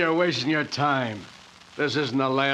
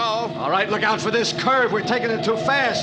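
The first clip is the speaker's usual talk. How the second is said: alarmed; 6 semitones higher, 3 dB louder than usual. Acted. Speeding car chase, warning about curve.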